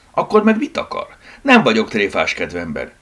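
loud, irritated challenge starting high; annoyed retort. Acted. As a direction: snap at the caller, out of patience. Annoyed retort after disappointment.

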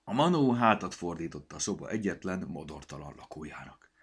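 ordinary delivery, nothing marked

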